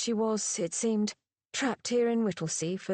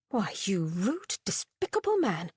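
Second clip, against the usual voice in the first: freeze him out with each word; icy. A narrator's reading. Woman rebuking the rude man, icy. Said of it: "said Margaret icily".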